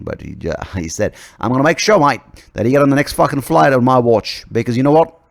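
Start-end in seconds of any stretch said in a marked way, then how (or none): 1.4-2.0 put-on loud, higher, pushy Aussie accent; mock Australian accent. Australian leader threatening a player, restored Aussie accent. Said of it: "because I'm Australian". 2.0-5.2 put-on loud, higher, bossy Aussie accent; mock Australian accent. Australian leader ordering a player onto the next flight, mock Aussie accent. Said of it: "because I'm Australian".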